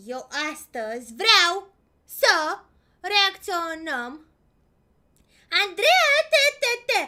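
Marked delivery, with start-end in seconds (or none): 0.1-4.1 slow deliberate announcement; slow drawn out. Real, not acted. Streamer slowly telling chat her plan, drawn out. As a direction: spell it out slowly.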